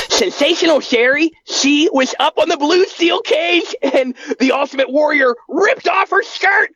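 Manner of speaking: high, giddy fanboy telling; Bart voice. Acted. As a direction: gush about the cage match. Bart excited storytelling.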